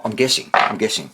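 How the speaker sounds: normal talking voice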